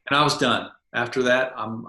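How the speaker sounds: ordinary delivery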